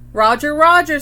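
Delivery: loud, high parrot squawk. A narrator's reading. Parrot repeats its name, loud high squawk. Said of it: "squawked the parrot".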